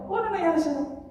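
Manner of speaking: lightly acted, high-pitched question; concerned passer-by. Businessman finds someone in the well, high questioning tone.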